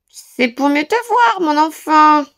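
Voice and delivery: wolf hiding behind a sweet voice; wolf grumbling in disguise. A narrator's reading. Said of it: "grommela le loup". (le loup déguisé, voix aiguë et lente)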